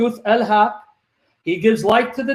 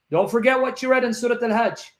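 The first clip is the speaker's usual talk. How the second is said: ordinary talk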